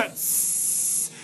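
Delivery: long, soft sibilant snake noise; snake hiss. Shown as a demonstration. Host doing best snake impression, long hiss. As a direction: draw out a voiceless hiss. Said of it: "do your best snake impression".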